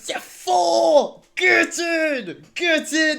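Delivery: loud, high triumphant yell; ecstatic cheering. Real, not acted. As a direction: cheer like your team just scored. Villa placed sixth, celebrating.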